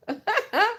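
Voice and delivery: short high giggle; high laugh. Real, not acted. Speaker laughing at her own question, high giggle. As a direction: burst into a giggle.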